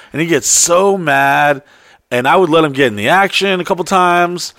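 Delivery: normal talking voice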